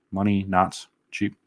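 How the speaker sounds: MONEY and CHEAP slowly spaced out; "Money not cheap" drawn out slowly; slow pondering repeat. Real, not acted. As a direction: mull over "Money not cheap", word by word. Repeats the comment, mulling it over.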